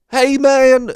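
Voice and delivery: loud, high Southern holler; drunk Southern accent. Retold or imitated. Drunk Southern guy calling out after the show, hammed-up drawl. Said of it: "because of his accent".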